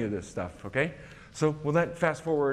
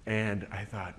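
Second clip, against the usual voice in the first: normal talking voice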